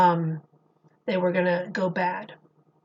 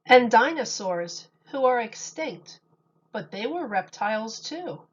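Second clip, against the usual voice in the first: gentle sing-song picture book reading; storybook voice for kids; reading to children. Acted. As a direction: read the rhymes to a little audience. Reads picture book aloud to children.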